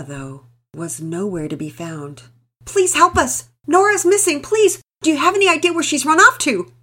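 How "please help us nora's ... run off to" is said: high, loud panicked cry for help; frantic yelling. Acted. Frantic mother begging neighbours at the door, high loud yell. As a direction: yell it out, desperate for your missing child. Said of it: "Missus Thompson practically yelled".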